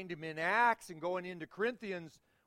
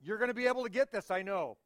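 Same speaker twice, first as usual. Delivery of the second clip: normal talking voice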